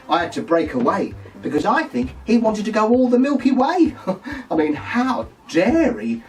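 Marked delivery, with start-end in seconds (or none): 4.0-4.5 high giggle. Real, not acted. Laughs at innuendo.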